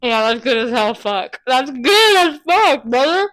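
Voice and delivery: talking through a mouthful of food; words around a big bite; mouth full. Real, not acted. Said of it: "I love talking with my mouth full".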